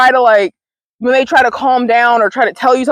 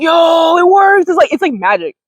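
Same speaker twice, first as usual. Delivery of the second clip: amazed yo; 7 semitones higher than usual. Retold or imitated. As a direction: blurt it out like you just found magic. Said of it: "I was like".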